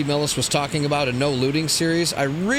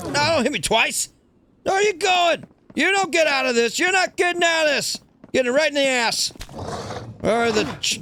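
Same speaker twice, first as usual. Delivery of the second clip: excited fight yelling; 10 semitones higher than usual. Real, not acted. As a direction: chase it down, shouting higher and higher. Fighting and chasing a zombie.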